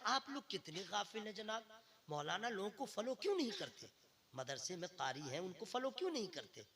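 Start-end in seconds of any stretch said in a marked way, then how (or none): none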